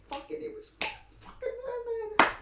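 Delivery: a sudden high, loud cry of frustration; frustrated outburst. Real, not acted. Fan groaning at the elbows his fighter never threw, high frustrated cry. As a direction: yelp it out like the finish just slipped away.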